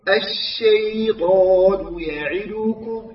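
"الشيطان يعدكم" ببطء وكسرة العين نصف ياء; رتّل "الشيطان يعدكم" دون خطف ولا مط; حركات تامّة. Shown as a demonstration. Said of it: "هكذا". (تلاوة "الشيطان يعدكم" الصحيحة المتأنية)

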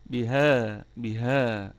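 بها with the long alif held evenly after ه; natural madd on ha. Shown as a demonstration. Teacher demonstrating natural madd, lengthened vowel in بها. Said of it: "والهاء فيها مد طبيعي".

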